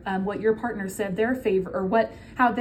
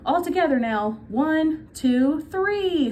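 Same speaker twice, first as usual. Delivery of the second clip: counting yell; 5 semitones higher, slower than usual. A narrator's reading. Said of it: "he yelled".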